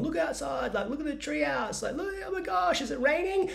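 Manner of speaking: very high, put-on excited squeal; mock amazement to divert a kid; soft high-pitched fake wonder; distracting a child. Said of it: "I'm trying to distract him".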